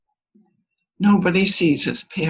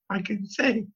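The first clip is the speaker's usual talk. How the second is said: usual speaking voice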